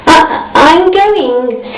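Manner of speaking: high, slow, stumbling start; hesitant. A narrator's reading. Miss Star tells the hostess, high and hesitant. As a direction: falter before the big news.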